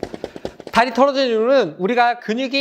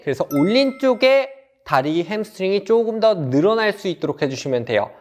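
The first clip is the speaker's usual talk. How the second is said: ordinary talk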